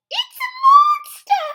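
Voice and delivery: very high, very loud frightened cry; Missus Blair scared. Acted. Missus Blair crying out that it is a monster. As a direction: shriek in mock terror. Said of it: "said Missus Blair".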